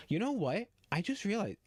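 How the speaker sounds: high, loud excited burst; sudden realization. Real, not acted. Streamer to chat, excited at a sudden idea. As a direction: jump up with the idea.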